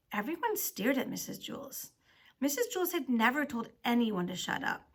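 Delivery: ordinary delivery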